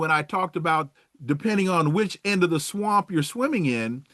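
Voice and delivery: ordinary talk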